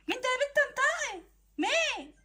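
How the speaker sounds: high, sharp questions pressing for a name; demanding interrogation. Acted. Wife demanding who told her friend, high and insistent. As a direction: grill her until she answers.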